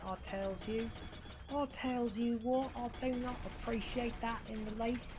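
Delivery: high, hammed-up British grumble; lightly put-on British accent, indignant; mock British accent. Gamer grumbling at the game, mock British accent. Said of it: "British accent".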